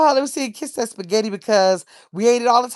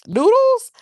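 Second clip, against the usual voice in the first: high, loud surprised "Noodles?"; surprised squeal; 6 dB louder than usual. Real, not acted. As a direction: squeal the word like it caught you off guard. Reading surprising chat suggestion.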